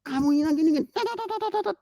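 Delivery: squeaky, very high mock nagging; ibu ngomel. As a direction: parody a mother's scolding dissolving into chatter. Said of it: "mau ibunya ngomel". (tiruan omelan ibu yang melengking tinggi)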